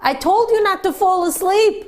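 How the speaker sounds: a lightly acted boss yelling upward; retold angry yell. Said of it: "he started to yell at the driver".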